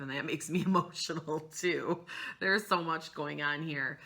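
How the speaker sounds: usual speaking voice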